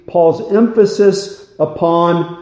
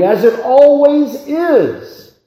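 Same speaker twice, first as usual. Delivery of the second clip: ordinary delivery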